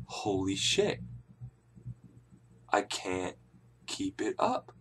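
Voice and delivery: barely audible, halting realization; hushed dismay. Retold or imitated. Man realizing he failed in bed, hushed dismay. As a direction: let it out in tiny, broken-up pieces.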